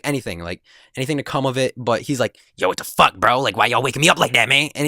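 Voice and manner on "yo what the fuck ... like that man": imitating a groggy friend's first, milder complaint, fast; mock annoyance. Woken friend complains to his two classmates, mildly annoyed imitation. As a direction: grumble it quickly as the irritated friend. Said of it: "working himself up in a way".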